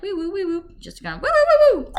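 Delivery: high little wee-woo siren noises; imitated vocal tics. Retelling ticking at the grocery store, imitated high tic noises. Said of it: "I was kinda ticking".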